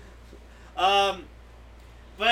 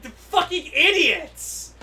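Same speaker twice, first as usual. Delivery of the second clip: high, loud, slow exasperated cry; imitated pissed-off outburst; 8 semitones higher, 6 dB louder, much slower than usual. Podcaster acting out Kurosaki finishing his call, pissed off. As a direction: stretch the insult out in mock fury. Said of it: "he's just so pissed off".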